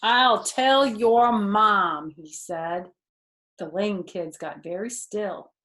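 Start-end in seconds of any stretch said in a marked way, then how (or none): 0.0-2.1 tough. A narrator's reading. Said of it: "Fox had to get tough".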